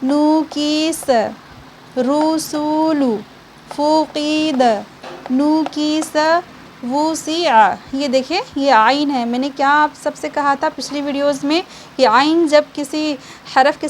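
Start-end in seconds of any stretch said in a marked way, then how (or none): 0.0-7.6 each syllable drawn out very slowly, one by one; slow letter reading. Shown as a demonstration. Teacher sounds out Qaida words slowly for students. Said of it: "demonstrates reading letters aloud".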